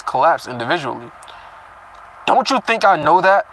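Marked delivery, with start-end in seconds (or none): 2.3-3.5 a touch of a sharp snap, slightly raised; read snap. Acted. Reader voicing Cecilia's snap at Nico, mildly sharp. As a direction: snap the line back, understated. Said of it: "I snapped".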